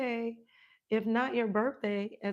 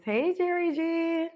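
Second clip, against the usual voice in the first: excited greeting; 6 semitones higher, 5 dB louder than usual. Real, not acted. Livestreamer hailing a viewer, loud and high. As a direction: greet the viewer with a big happy shout.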